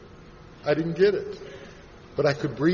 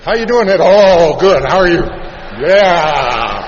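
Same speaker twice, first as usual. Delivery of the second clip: much louder, hammed-up greetings; loud jittery greeting; 2 semitones higher, 13 dB louder, much slower than usual. Shaky newcomer greeting people at a meeting, loud and hammed-up. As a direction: blurt it out far too loudly.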